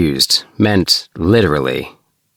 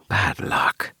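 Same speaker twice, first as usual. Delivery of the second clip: soft, gentle sympathy, noticeably quieter; said gently; 5 dB quieter than usual. Acted. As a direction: console him softly. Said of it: "he said gently".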